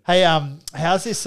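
ordinary talk, nothing marked

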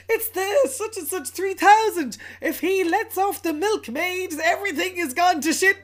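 high, loud panicked-elf impersonation; hammed-up urgent warning, pitched high; mock alarm. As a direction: act out an elf raising the alarm. Elf's alarmed warning acted out.

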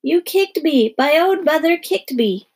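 high-pitched wailed accusation; screaming child. A narrator's reading. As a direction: cry out in acted outrage. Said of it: "Melody screamed".

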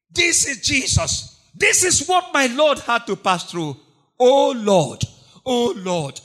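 loud, high grieving cry of oh Lord; anguished lament. Acted. Preacher voicing Peter weeping over Jesus, loud anguished lament. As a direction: wail it out like a man in pain.

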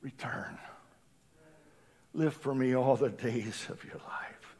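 soft unvoiced whisper, far away; whispered voice of the Lord. Preacher voicing the Lord calling the stray back, whispered. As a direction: breathe it like a divine call. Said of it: "I hear the voice of the Lord saying".

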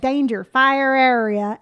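slow, drawn-out Southern drawl; Southern accent. Retold or imitated. Mom reading road signs to laughing kids, Southern accent. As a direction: imitate Mom's Southern accent, stretching each word. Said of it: "Southern accent".

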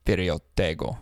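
low, quiet Russian accent on Furio Tego; a hint of Russian in the name. Shown as a demonstration. Asked for a Russian version, he says it in a soft hint of Russian. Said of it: "Could we do a Russian Furio Tego?".

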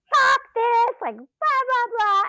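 high, loud mock rage; raging rant. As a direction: vent furiously at the hill. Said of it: "I'm, like, fully raging".

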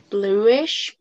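ordinary delivery

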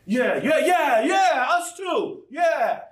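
hammed-up eager agreement; eager disciples chorus. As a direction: disciples chiming in, high and loud. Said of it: "they all said the same".

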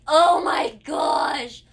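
very slow, loud, drawn-out "oh my gosh"; exasperated groan. Retold or imitated. Seeing her friend walk over after the loss, a slow loud groan. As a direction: stretch it into a long, loud groan of dread. Sees friend coming to chat.

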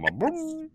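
very high mouth sound; mouth noise. Retold or imitated. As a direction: hum it like a machine. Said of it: "how they do with their mouths".

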